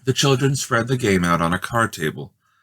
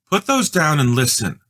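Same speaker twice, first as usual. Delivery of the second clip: higher and louder bossy sister; bossy order; 7 semitones higher, 4 dB louder than usual. A narrator's reading. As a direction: snap it at her brother. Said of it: "said Judy".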